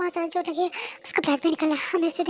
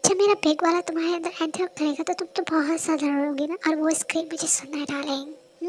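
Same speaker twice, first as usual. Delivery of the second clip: ordinary talk, nothing marked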